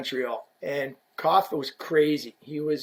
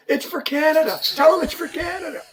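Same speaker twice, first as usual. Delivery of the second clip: high, loud, desperate begging; pleading shout; 12 semitones higher, 3 dB louder than usual. Retold or imitated. Player begging the rep at three in the morning, high pleading cry. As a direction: beg like it's for the whole nation. Repeats Koff's plea.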